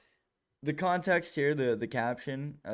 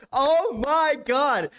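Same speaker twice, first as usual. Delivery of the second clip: exasperated groan; 13 semitones higher, 8 dB louder, much slower than usual. Real, not acted. Reacting to cringe sales clip.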